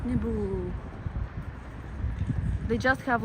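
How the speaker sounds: normal talking voice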